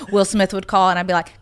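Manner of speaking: usual speaking voice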